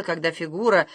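ordinary delivery, nothing marked

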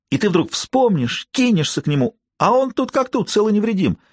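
taunting rise into high singsong; mocking. A narrator's reading. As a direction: sing it up mockingly. Peter mocking prediction.

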